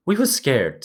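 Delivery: high and eager; excited. A narrator's reading. Piggy excitedly repeating their fear to Ralph. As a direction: rush it out, excited. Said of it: "said Piggy excitedly".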